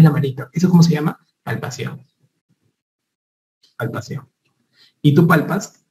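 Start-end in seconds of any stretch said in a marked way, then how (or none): 1.4-2.0 susurrado, sin voz; susurro. Shown as a demonstration. Susurra el término al alumno. 3.8-4.3 susurrado, sin voz; susurro. Shown as a demonstration. Repite el término susurrando.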